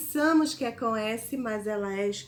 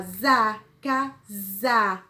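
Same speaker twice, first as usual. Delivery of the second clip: za and casa said louder, z sound punched; syllable emphasized; 5 dB louder, much slower than usual. Shown as a demonstration. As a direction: hit the za syllable for the class. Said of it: "Na segunda sílaba".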